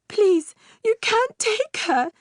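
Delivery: very high, strained plea; desperate pleading. Acted. Mother pleads with the witch for her daughter. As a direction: beg with everything you have.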